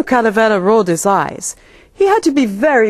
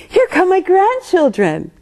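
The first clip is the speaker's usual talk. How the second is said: delighted; 9 semitones higher than usual. A narrator's reading. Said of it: "Grandma Beetle exclaimed".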